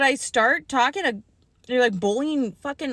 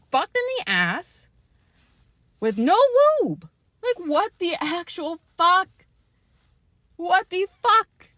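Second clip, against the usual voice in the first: outraged; 5 semitones higher, 4 dB louder than usual. Real, not acted. Outraged at unfairness.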